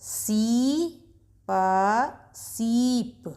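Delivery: slow, stretched blending of syllables; reading to children. Acted. Teacher guiding young readers through words, slowly. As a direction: sound out each piece, then join gently.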